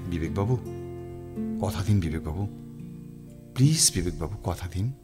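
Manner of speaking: low, hushed entreaty; coaxing plea. Acted. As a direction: softly press him for a promise. Publisher coaxing writer for a promise.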